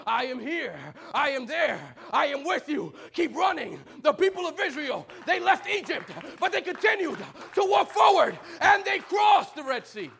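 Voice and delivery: loud, high shouting sermon; full-force preaching. Acted. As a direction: preach it to the rafters.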